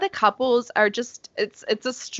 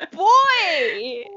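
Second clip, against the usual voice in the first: mock exasperated boy; 8 semitones higher, 3 dB louder than usual. Mocking Amelia's reaction.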